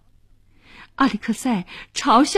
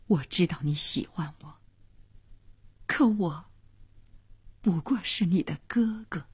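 low, soft, measured reassurance; earnest, heartfelt; 5 semitones lower, 3 dB quieter than usual. A narrator's reading. Young man lets a girl down as a brother, earnest and gentle. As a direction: speak gently and seriously, in a lowered voice. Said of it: "语重心长地说".

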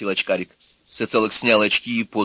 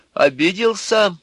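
higher, drawn-out anxious query; nervous question; 10 semitones higher, 4 dB louder, slower than usual. A narrator's reading. Nervous man asking the electrician, jittery. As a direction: ask it on edge. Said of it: "спросил нервно".

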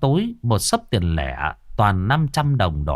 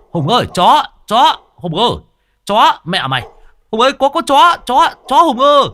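much higher, loud terrified yelling; panicked shouting; 13 semitones higher, 8 dB louder than usual. Acted. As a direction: scream for Hùng while fending off a dog. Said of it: "mồm thì quát con chó". (la hét hoảng loạn, giọng cao vút)

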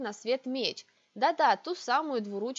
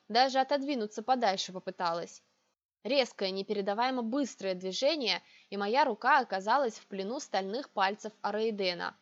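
plain reading voice

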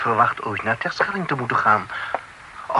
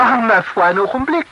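higher and louder, throwing up his hands; exasperated outburst; 8 semitones higher, 5 dB louder, faster than usual. Acted. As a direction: stop the flood of questions, flustered. Flooded with questions, exasperated. (geïrriteerd uitroepen)

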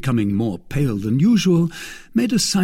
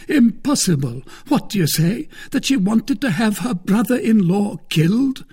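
high-pitched disbelief; astonished cry; 10 semitones higher, 4 dB louder than usual. A narrator's reading. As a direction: exclaim it, aghast and incredulous. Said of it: "cried Porthos".

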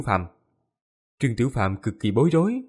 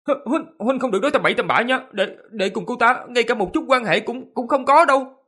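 high-pitched, stammering panic, louder at the end; flustered denial; 11 semitones higher, 3 dB louder than usual. A narrator's reading. Trương Tiểu Phàm denies any relationship, flustered and high-pitched. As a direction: blurt out a panicked denial, voice shooting up. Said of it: "hoảng hốt không ít, mặt mày trắng bệch rồi nói lẹ".

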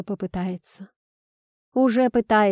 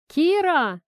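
raised, high-pitched call; yelling; 8 semitones higher, 4 dB louder than usual. A narrator's reading. Father calls Kira from the kitchen, yelling. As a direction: call out from another room. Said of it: "заорал Игорь из кухни".

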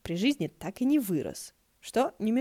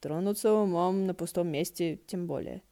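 ordinary delivery

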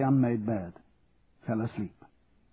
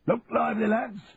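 shouted drill order; 10 semitones higher than usual. Acted. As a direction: chivvy the marching lads. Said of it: "Charlie had shouted at him".

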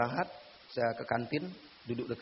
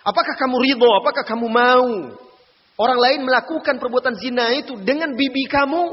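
high, loud preacher's challenge to the listener; forceful questioning; 11 semitones higher, 12 dB louder than usual. Retold or imitated. Preacher voicing the Prophet challenging the young man, loud and high. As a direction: hammer the question home at full voice.

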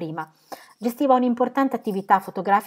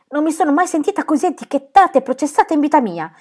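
high, louder outraged cry; indignant exclamation; 9 semitones higher, 7 dB louder than usual. Acted. Phyllis protests the aunt's treatment, high indignant cry. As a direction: protest being judged. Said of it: "esclamò Phyllis".

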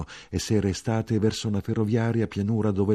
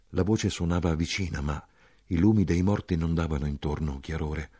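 usual speaking voice

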